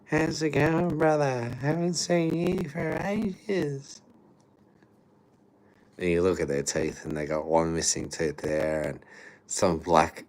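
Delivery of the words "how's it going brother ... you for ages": high, slow put-on greeting voice; impression of the greeter. Shown as a demonstration. Showing how these strangers greet him, high put-on drawl. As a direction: hit the overfriendly high drawl. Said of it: "I can do it perfect".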